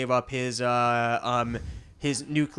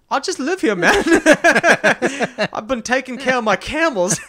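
mock innocent plea; 9 semitones higher, 6 dB louder than usual. British ISIS kid pleading innocence.

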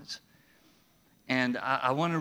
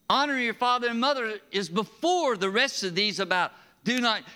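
usual speaking voice

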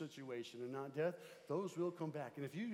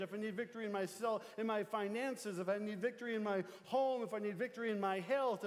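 ordinary talk

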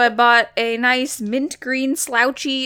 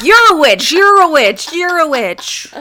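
louder, pointing accusing voice; 5 semitones higher, 6 dB louder than usual. Retold or imitated. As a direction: accuse each one in turn. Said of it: "It's one dude. Like".

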